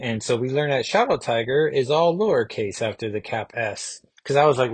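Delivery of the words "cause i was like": normal talking voice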